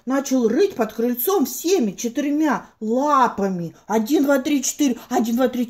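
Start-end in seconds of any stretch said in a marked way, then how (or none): none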